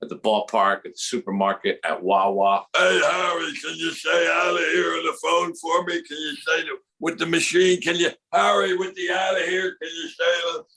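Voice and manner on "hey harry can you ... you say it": pestering fans. Acted. Fans asking Harry at the ballpark, pestering and eager. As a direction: call out a request like an excited fan. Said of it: "People would come up to him".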